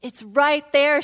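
neighbor insisting. Retold or imitated. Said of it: "she's saying".

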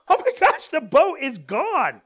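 high, loud cry of disbelief; shocked discovery. Retold or imitated. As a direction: exclaim in alarm, drawing out the last words. Acting out finding boat gone.

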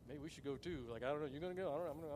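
a hint of nervous, hushed wavering; lightly voiced uneasy men muttering; anxious soldiers.

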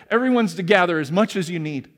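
usual speaking voice

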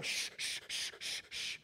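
repeated sharp shushes; shushing. Acted. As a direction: hush someone with a string of shushes. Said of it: "everyone's telling him".

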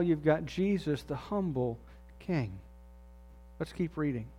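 ordinary delivery

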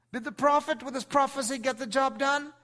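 loud, high, ringing preacher's voice; full-force sermon climax; preaching at full force. Acted. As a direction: drive the point home to the congregation.

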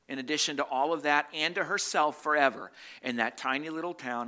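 loud, high, driving sermon proclamation; preaching at full force. Acted. Preacher proclaiming Mary's cost to the congregation, full force.

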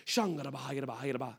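rapid low stream of tongues; imitated tongues, fast and soft; praying in tongues. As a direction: rattle off syllables as mock tongues. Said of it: "pray in tongues like this".

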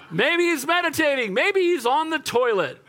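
high, teasing sarcasm; Elijah mocking. Prophet jokes about the rivals' absent god, mocking. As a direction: play it for laughs, taunting. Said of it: "Elijah mocked them".

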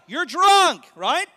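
loud, high scoffing retort; mock accusation. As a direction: brush her off with a loud jeer. Acted quarrel at the gate.

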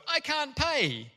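high, slow, whiny plea; pleading debtor. Retold or imitated. Second debtor begging the forgiven man, high slow plea. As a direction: squeak it out, begging for mercy. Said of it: "that guy goes".

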